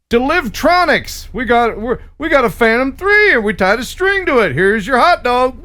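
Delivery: mock startup pitch. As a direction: sell it like an overeager startup. Mocking a drone delivery startup.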